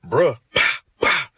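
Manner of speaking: a loud "pah, pah" imitating gunshots; gunshot sounds. Telling a friend about the shooting, loud mock gunshots. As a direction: punch out each "pah" like a shot.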